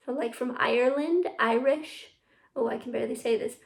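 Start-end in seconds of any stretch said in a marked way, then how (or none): none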